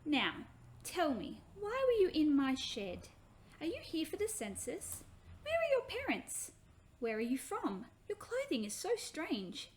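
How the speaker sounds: lightly voiced young mother, puzzled and questioning; curious young woman. Mary questions the children.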